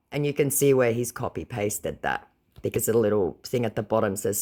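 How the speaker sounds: ordinary delivery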